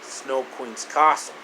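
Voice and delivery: ordinary delivery, nothing marked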